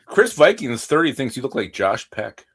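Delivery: normal talking voice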